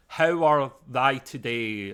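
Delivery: slow, formal posh English voice; stately posh English, each word stretched. Retold or imitated. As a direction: imitate old-fashioned posh English, drawn out. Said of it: "say it slowly and very posh and professional".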